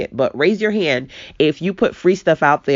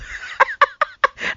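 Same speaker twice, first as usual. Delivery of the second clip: high giggle. Real, not acted. Laughing at own slip.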